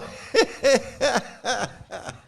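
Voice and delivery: high laughter trailing off; real rolling laughter; hearty laugh. Real, not acted. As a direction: laugh it out freely. Laughing with the audience.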